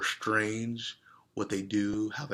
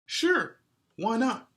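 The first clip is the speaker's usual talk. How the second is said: much higher, bright eager reply; grinning; 13 semitones higher, 6 dB louder, slower than usual. Acted. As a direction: answer with a big grin. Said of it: "Salma grinned".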